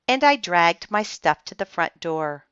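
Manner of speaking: usual speaking voice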